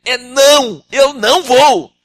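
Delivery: loud high refusal, slow; stubborn Moses. Acted. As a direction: dig in and refuse God loudly.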